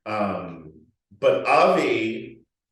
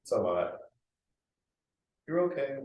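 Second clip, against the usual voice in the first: soothing reassurance; 5 semitones lower, 8 dB quieter than usual. Retold or imitated. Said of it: "She's like".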